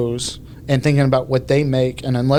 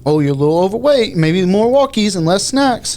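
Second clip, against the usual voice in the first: higher, faster cutesy pet talk; little dog voice; 7 semitones higher, 4 dB louder than usual. Retold or imitated. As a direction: coo it like talking to a puppy. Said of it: "talked in a little dog voice".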